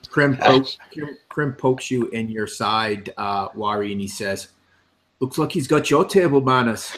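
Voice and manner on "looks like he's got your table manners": teasing jab. Acted. Krim teases Wari.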